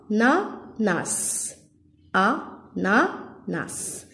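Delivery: very slow "Ananas", split a, na, nas; syllable by syllable. Shown as a demonstration. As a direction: pause after each syllable of "Ananas". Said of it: "La dernière syllabe". (« Ananas » lentement, syllabe par syllabe)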